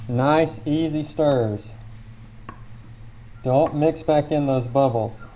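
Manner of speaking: talking through a face mask; muffled voice behind a mask. Real, not acted. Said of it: "sorry for the muffled tones because I've gotta wear this, this mask".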